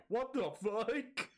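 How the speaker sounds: slow, stunned mock deadpan; drawn-out disbelieving line, lightly imitated; deadpan what the fuck. Streamer imitating the deadpan cook's tone, slow and stunned. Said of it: "It's said in that tone?".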